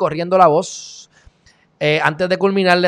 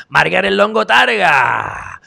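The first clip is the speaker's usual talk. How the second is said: announcer-style roll call; 4 semitones higher than usual. Acted. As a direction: stretch each name like a showy announcer. Reading out viewers' names and comment.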